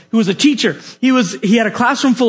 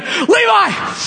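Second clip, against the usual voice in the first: calling a name out high with joy; excited call; 11 semitones higher than usual. Retold or imitated. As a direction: burst in calling his name, thrilled. Bursting into colleague's office.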